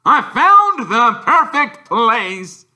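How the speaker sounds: high, loud, slow gloating; triumphant Vernon. A narrator's reading. Uncle Vernon to the family, loud gloating. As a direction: crow it out slowly.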